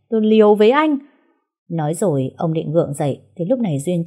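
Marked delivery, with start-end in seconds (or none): 0.1-1.0 furious outburst. Acted. Old man furious after falling.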